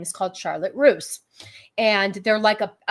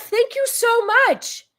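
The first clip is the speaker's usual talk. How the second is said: excited; 14 semitones higher, 4 dB louder, slower than usual. Real, not acted. Thanking donor, very high.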